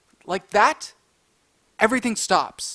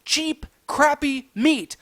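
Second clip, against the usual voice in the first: usual speaking voice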